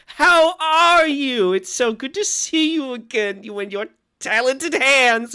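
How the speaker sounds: slow, high, loud gushing; gushing hostess. Acted. Franny holding Esther's cheeks, gushing. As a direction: gush over her like a doting aunt. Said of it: "and she says".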